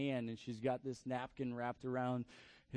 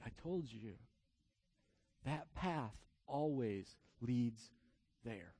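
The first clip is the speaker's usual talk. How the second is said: very soft, slow and sinking low; grave gentle reminder; 4 semitones lower, 6 dB quieter, much slower than usual. Retold or imitated. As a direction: let it drop to a hushed, solemn close. God's sorrowful reply, voiced.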